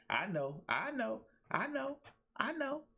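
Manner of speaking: sing-song rising concession, step by step; climbing I knows. Real, not acted. Coach conceding the point to listeners, rising pitch each time. Admits it's cliche, climbing.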